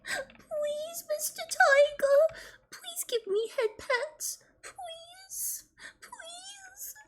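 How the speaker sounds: put-on high, slow baby-talk pleading; cutesy voice. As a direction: beg like a needy little kid. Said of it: "Only cutesy voice".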